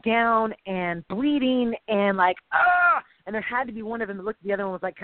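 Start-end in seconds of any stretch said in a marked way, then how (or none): 2.5-3.0 loud, higher cry of strain; agonised "ah"; pained cry. Retold or imitated. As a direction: cry out like a beaten rower. Mimics broken, beaten slave rowers.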